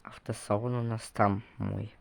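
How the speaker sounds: ordinary delivery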